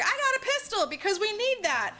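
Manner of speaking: high-pitched retold declaration; hammed-up readiness; mock eager announcement. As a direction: pipe up high, acting the helper. Help eagerly announces pistol.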